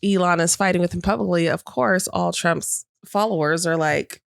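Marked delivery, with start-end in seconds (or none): none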